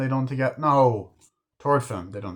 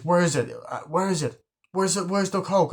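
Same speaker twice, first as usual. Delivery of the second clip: frantic searching; 7 semitones higher than usual. Retold or imitated.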